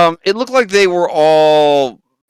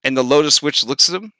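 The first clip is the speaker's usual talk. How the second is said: normal talking voice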